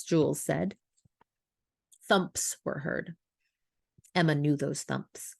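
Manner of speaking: ordinary delivery, nothing marked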